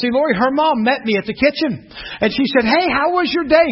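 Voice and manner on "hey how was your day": high, eager mother-in-law's greeting; bubbly, high-pitched hello; very excited greeting. Retold or imitated. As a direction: imitate an excited mom greeting. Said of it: "'Cause she's very excited".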